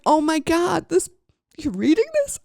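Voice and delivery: high, loud, thrilled gasp of recognition; excited disbelief. Retold or imitated. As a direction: squeal it like you can't believe your luck. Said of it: "I'm like".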